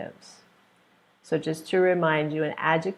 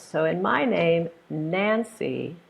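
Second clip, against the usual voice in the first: ordinary talk, nothing marked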